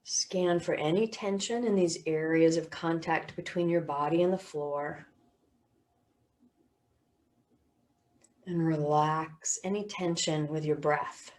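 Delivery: measured body-scan voice with long pauses; guided meditation. Real, not acted. Teacher guiding listeners through body and sounds, guided meditation.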